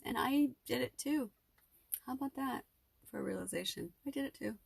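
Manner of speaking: holding back tears at a realization; close to tears. Real, not acted. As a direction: voice rising, near breaking. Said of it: "I'm not gonna cry".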